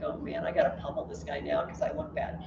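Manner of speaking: muttered to himself. Retold or imitated. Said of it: "'Cause he's like".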